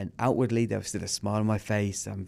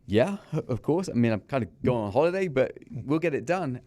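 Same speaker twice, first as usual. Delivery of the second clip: eager yes; 4 semitones higher, 3 dB louder than usual. Retold or imitated. Eagerly accepting a job offer.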